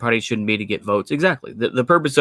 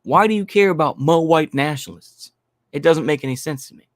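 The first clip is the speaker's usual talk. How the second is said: ordinary talk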